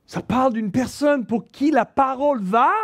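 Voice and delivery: ordinary delivery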